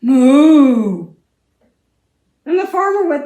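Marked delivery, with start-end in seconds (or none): 0.0-1.1 long loud moo; cow moo. Acted. Storyteller voices the pig mooing at the farmer. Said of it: "the pig looked at him and went".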